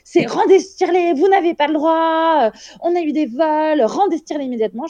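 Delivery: high, agitated mock scolding; imitating a shrill scolding nurse; bossy nurse. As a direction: play the stern hospital staff demanding it back. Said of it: "quatre nanas du service ... en disant".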